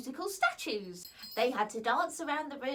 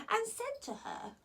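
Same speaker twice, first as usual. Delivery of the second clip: ordinary delivery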